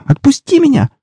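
put-on very high girlish squeak; squeaky, panicked girl's cry; frightened squeal. A narrator's reading. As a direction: squeal to be let go, pitch shooting up. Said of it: "пискнула девушка".

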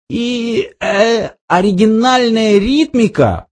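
high, hesitant critic; high-pitched, feeling for the next phrase; Arthur. A narrator's reading. Arthur improvising praise of the captain's poetry, high and hesitant. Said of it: "продолжал Артур".

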